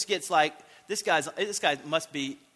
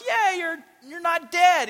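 much higher and louder, a hammed-up excited yell; freaking out; 11 semitones higher, 11 dB louder, slower than usual. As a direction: blurt it in mock shock that he lived. Disciples freaking out over Paul.

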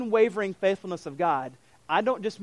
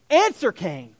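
firm demand; 7 dB louder, slower than usual. Acted. Preacher voicing God's demand.

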